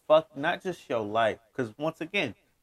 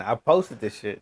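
ordinary delivery

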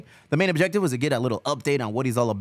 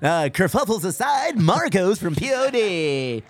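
higher, slower announcer voice; 7 semitones higher, slower than usual. Pretend. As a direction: introduce the guest like a radio host.

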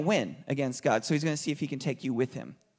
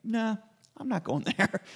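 lion backing down; 6 semitones higher than usual. Retold or imitated. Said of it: "He's like".

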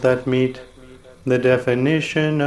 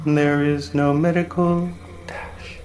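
ordinary delivery

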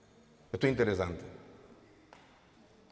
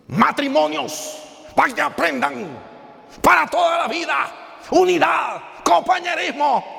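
preacher shouting; 8 semitones higher, slower than usual. Retold or imitated. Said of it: "dijo el pastor". (imitando al pastor que grita en el retiro)

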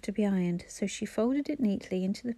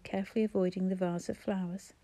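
ordinary delivery

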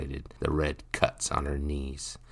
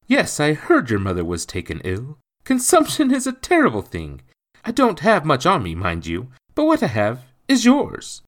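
high, loud, swooping concern; kindly sympathetic gentleman; 11 semitones higher, 8 dB louder than usual. A narrator's reading. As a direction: offer help with emphatic sympathy. Gentleman pities the boy.

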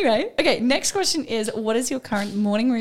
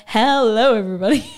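game show host greeting; 6 dB louder than usual. Shown as a demonstration. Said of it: "I'll be like".

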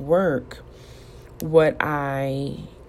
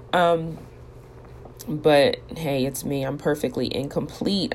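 normal talking voice

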